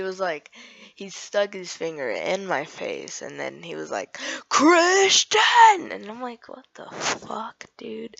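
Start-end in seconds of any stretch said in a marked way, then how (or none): 4.5-5.9 high, loud mock shout; full-force imitation of an angry yell. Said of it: "while he was yelling at me". 6.5-8.1 slow, hushed disbelief; retold shock under the breath; stunned whisper. Stunned aside after being yelled at.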